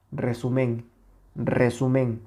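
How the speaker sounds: resumen with SU light and MEN stressed. Shown as a demonstration. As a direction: shift the weight to the end: resu-MEN. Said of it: "le acabamos de quitar la intensidad a esta sílaba".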